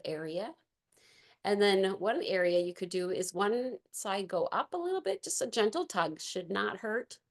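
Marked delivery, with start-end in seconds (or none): none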